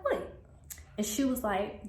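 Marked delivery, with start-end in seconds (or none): 0.0-0.3 high-pitched retold "what?"; a quick, high question of disbelief; surprised. Surprised reply to the news.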